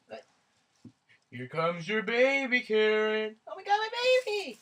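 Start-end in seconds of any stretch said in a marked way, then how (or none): 3.5-4.6 high, fast gushing; excited squeal. Acted. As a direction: squeal over the new baby. Karen sees baby.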